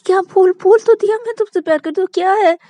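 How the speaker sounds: high, loud stammering panic; terrified shriek. Acted. Shruti shrieks at Shreya to stop speeding, terrified. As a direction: shriek at the speeding driver in terror. Frantic forced confession.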